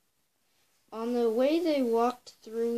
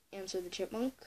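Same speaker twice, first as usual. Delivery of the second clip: ordinary delivery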